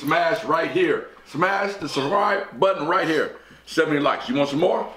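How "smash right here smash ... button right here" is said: slow emphatic plug. Acted.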